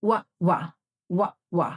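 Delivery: rounded lips gliding into "ওয়া"; w sound drilled as "ওয়া"; English w sound. Shown as a demonstration. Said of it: "ডাব্লিউ sound হচ্ছে".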